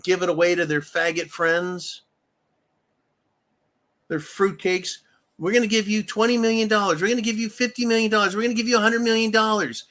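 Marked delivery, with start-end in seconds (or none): 5.4-9.8 rapid-fire, higher-pitched mock offer; fast, escalating imitation of lavish generosity; mock eager giveaway. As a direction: rattle off the offers like an auctioneer. Mocks Congress handing out money fast.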